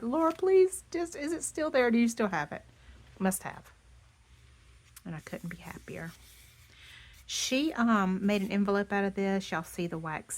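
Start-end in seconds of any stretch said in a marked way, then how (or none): 0.0-2.6 high, loud, eager begging; eager plea. Retold or imitated. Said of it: "I'm like". 5.1-6.1 whispering. Real, not acted. Happy aside.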